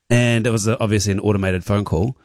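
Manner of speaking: ordinary talk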